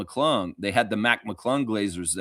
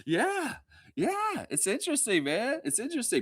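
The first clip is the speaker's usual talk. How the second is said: soft high musing; 11 semitones higher, 7 dB quieter than usual. Real, not acted. Musing softly to the chat after laughing. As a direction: let it float up softly.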